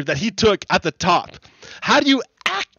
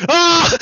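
laughing shriek; 10 semitones higher, 5 dB louder than usual. Real, not acted.

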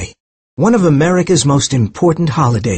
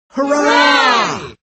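very high cheering shout; crowd shout; 12 semitones higher than usual. A narrator's reading. The crowd cheers at the parade, shout. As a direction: cheer for the patriots. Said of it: "Everyone shouted".

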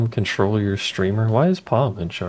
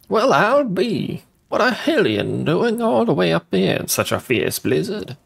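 bird voice; 9 semitones higher than usual. Acted. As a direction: put on a high bird-creature voice, marvelling. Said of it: "That's how birds talk".

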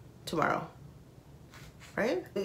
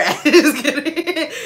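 higher laughing "I'm just kidding"; 7 semitones higher, 6 dB louder, slower than usual. Real, not acted. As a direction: giggle it out. Laughing after getting hyped.

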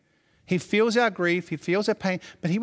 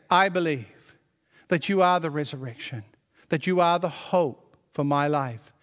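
prayerful declaration; 6 semitones lower than usual. Pretend. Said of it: "It's time to say".

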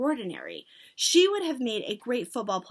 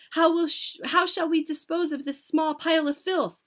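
higher, raised questioning outcry; cried out; 6 semitones higher, 5 dB louder than usual. A narrator's reading. Witches asking how to dispose of him, cried out. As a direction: call the question out eagerly. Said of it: "they cried out".

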